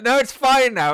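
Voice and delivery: high, loud mock insistence; high-pitched emphatic reassurance; retold U-turn. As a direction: imitate a sudden breezy change of mind, loudly. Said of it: "and then it's like".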